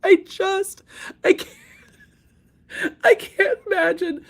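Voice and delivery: squeaking very high through helpless laughter; cry-laugh, barely getting words out; laughing fit. Real, not acted. Cracking up over the lawsuit, a high laughing squeak.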